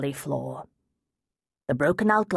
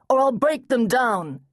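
louder, higher threatening cry; loud threat; 7 semitones higher, 3 dB louder than usual. Acted. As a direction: warn them he will force it. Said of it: "he cried".

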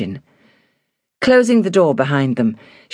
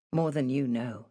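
soft, rueful private thought; 13 dB quieter than usual. A narrator's reading. Hilary silently answers his concern, ruefully. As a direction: think it softly, with mild regret. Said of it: "she thought ruefully".

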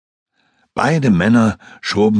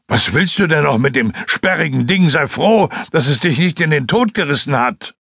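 a higher, scolding hunter voice; gruff disbelief, raised pitch; exasperated Lasnik; 8 semitones higher than usual. Acted. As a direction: tell him off for clinging to wreckage. Lasnik scolds Joskun in water.

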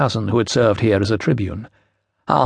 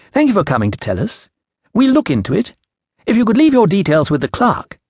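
lightly voiced official, raised in pitch; young Roman official; 8 semitones higher than usual. A narrator's reading. Assistant procurator sends the woman to the clerk, polite dismissal. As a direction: give a polite official brush-off in a higher voice.

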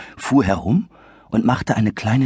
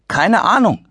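put-on higher, cautious young boy; lightly boyish; 12 semitones higher, 4 dB louder than usual. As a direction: play it safe like a kid. Boy Adi answers the lady.

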